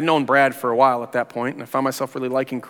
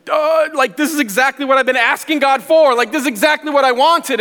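much higher, louder burst of joy; excited; 9 semitones higher, 4 dB louder than usual. Retold or imitated. Hearing the job offer, overjoyed and much higher. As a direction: gush like a prayer just came true.

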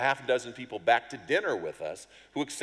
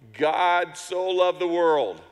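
halting first sermon line; 7 semitones higher, 9 dB louder, much slower than usual. Retold or imitated. Hesitant first street sermon line.

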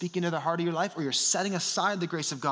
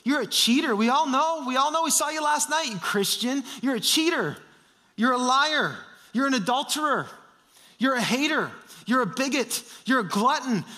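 voice of shame; 6 semitones higher than usual. Acted. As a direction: sneer the accusations like a cruel prosecutor. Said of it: "Shame would say".

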